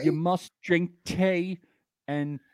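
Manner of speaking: hammed-up British rule list; mock British accent. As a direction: play a fussy Brit giving rules.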